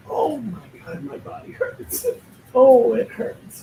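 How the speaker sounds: hammed-up sore-body moaning; mock groaning complaint. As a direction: ham up aching all over. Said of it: "you're gonna start hearing me say".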